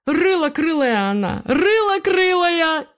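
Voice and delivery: very high, stretched bird taunt; shrill cooing jeer. A narrator's reading. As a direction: coo the nickname mockingly. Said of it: "Истошно закурлыкали одноклассники".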